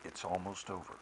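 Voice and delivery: calm someone in distress, softly; soothing a loved one who is struggling; gentle comfort. Shown as a demonstration. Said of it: "can gently say to ourselves".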